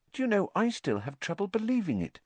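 high, musing tone; thoughtful. A narrator's reading. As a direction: wonder aloud, still not believing. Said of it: "said Madame Rosmerta thoughtfully".

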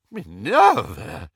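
shocked outcry, drawn out slow; aghast. A narrator's reading. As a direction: gasp the name in shock. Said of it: "he said, aghast".

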